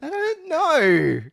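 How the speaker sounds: high, long drawn-out cry; loud insistence. Acted. Insisting to laughing co-host, drawn-out high cry. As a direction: stretch it out high and loud for comic effect.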